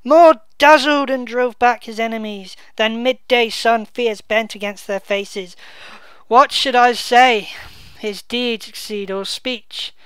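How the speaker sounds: slow, loud, declaiming verse; dramatic Shakespeare reading. Acted. Bedford mourning the dead king, slow loud declamation. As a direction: proclaim it like a grieving duke on stage. Reads Gloucester's praise aloud.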